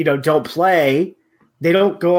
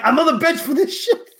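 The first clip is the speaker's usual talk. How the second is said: much higher put-on voice, indignant; [name]; 12 semitones higher than usual. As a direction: play a fed-up benched player griping. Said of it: "doing that impression".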